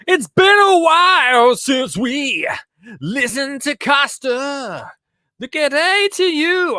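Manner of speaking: high, drawn-out shouted greeting; loud opening. Real, not acted. Host greeting Costa and listeners after a long while, loud and high. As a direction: holler the greeting, stretching the words out. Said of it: "that was quite loud to start off with".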